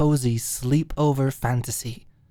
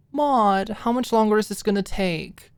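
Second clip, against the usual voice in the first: higher, nagging whine on the name; whining; 8 semitones higher, 3 dB louder than usual. Retold or imitated. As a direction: pester her impatiently. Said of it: "whined would be the better word".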